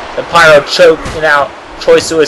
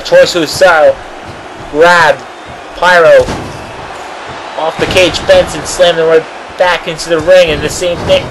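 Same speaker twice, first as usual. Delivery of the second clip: ordinary talk, nothing marked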